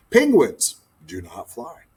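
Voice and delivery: plain reading voice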